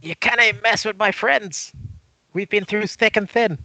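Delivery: high, slow, put-on defiant voice; hammed-up loyal defiance, pitched well up; defiant inner retort. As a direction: declare it high and drawn out. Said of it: "I say in my head".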